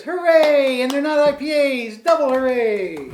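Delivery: loud, bright cheer for the beer lineup; excited cheer. Real, not acted. Host cheering that the beers aren't IPAs, loud and excited. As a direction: celebrate like the news is great.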